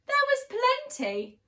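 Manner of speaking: high, bright emphasis; excited. Acted. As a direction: relish the plenty.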